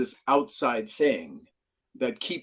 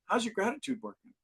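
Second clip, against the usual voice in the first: calm mentor; 9 semitones higher, 9 dB quieter, faster than usual. Retold or imitated. Mentor answers on the phone.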